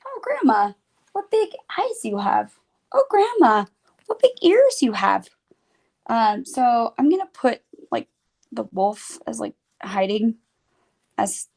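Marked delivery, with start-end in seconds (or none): 0.1-5.3 put-on high, wide-eyed little girl; high sing-song storybook girl voice. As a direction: play it as an innocent child marveling. Little Red voices classic lines.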